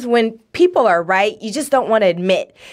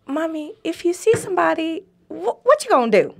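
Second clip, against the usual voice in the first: voicing her young son; 7 semitones higher than usual. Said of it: "And so my son goes".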